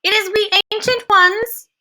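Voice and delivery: lightly voiced meek old hag. A narrator's reading. Ancient witches answering through the bedroom door, meekly. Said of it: "a meek voice".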